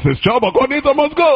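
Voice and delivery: high, quick protest cry; defiant agitator. Acted. Agitators answer objection that this is church, high insistent cry. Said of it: "And they say".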